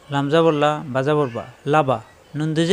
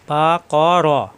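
"বা ক র" drawn out very slowly, each syllable held; 3 dB louder, much slower than usual. Shown as a demonstration. Teacher sounding out the word for learners, very slow separate syllables in "বা ক র". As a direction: stretch "বা ক র" so learners hear every vowel.

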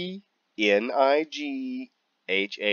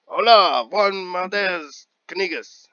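mock Spanish greeting; 8 semitones higher, 5 dB louder than usual. Joke Spanish greeting mocking name.

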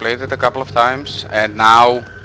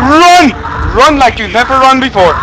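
high panicked shouts; screaming in panic; panicked yelling; 9 dB louder than usual. Real, not acted. Panicked fleeing in horror game.

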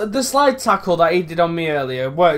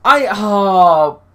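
drawn-out frustrated groan; 4 semitones higher, 6 dB louder, much slower than usual. Real, not acted. Missed chance, frustration.

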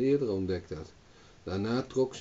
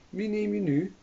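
daughter voice; 10 semitones higher than usual. Acted. Daughter's voice asks.

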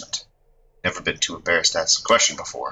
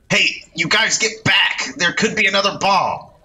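much higher, calling the crowd back; urgent warning shout; 13 semitones higher than usual. Acted. Reporter warning onlookers away from a bomb, urgent shout. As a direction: yell a warning over the chaos.

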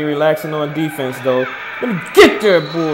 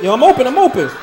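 higher, louder call for the ball; 9 semitones higher, 7 dB louder, faster than usual. Real, not acted. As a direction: shout that you're open. Calling for the ball.

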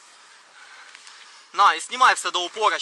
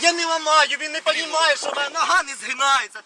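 high, strained insistence; exasperated protest; 6 semitones higher than usual. Real, not acted. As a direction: protest desperately, pitched well up. Pleading that leg will not bend.

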